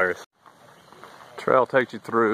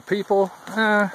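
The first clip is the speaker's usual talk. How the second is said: much higher, dismissive shrug in the voice; wry disappointment, pitched up; unimpressed eh; 11 semitones higher, slower than usual. Real, not acted. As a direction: sniff at the people.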